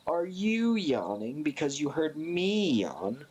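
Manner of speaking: usual speaking voice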